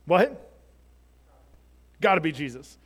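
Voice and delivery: normal talking voice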